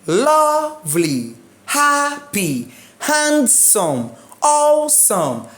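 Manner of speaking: LOVE-ly, HAP-py, HAND-some, AWE-some, each high then falling; first-syllable stress. Shown as a demonstration. Teacher drilling word list, high first syllables in "lovely, happy, handsome, awesome". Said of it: "you take pre up".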